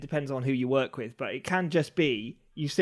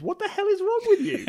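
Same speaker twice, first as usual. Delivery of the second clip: baffled medieval peasant. Retold or imitated. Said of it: "they'd be like".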